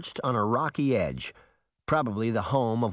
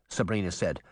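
usual speaking voice

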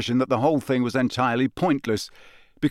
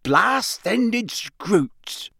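big, hearty half-giant, pitched higher, drawing out the last words; Hagrid's voice; 8 semitones higher than usual. Acted. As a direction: gamekeeper proudly showing off his creatures.

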